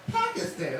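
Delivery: "Pakistan" with short a's, like "pack-is-tan"; TV pronunciation. Shown as a demonstration. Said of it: "That's how they say it on T V".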